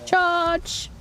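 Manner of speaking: high, drawn-out mock charge call; playful battle cry. Player charging in on her mount, playful battle cry.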